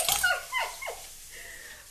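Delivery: high airy embarrassed giggle; a quick laugh at herself; 1 breathy giggle. Real, not acted.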